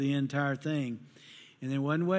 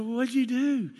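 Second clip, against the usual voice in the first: concerned dad; 9 semitones higher, 6 dB louder than usual. Retold or imitated. Dad questioning daughter.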